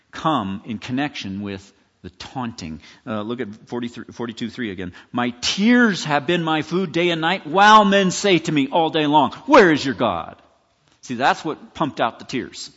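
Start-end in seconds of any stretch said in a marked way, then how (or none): 5.1-10.4 loud, forceful scripture recitation; raised voice, pressing through to a hard challenge; impassioned lament. Acted. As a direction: drive the verse home, building to the taunt. Psalm quote with enemies' taunt.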